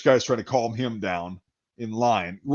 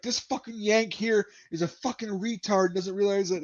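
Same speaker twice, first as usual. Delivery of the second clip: high-pitched imitation of a fed-up Brit; angry tirade; 7 semitones higher, 5 dB quieter than usual. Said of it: "he starts going on this tirade".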